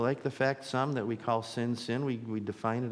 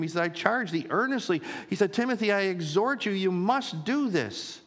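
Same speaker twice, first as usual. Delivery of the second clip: high, raised, insistent pulpit voice; full-force preaching; 5 semitones higher, 4 dB louder than usual. Real, not acted. Pastor exhorting as Paul to Timothy, full-force preaching. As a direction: exhort them with mounting urgency.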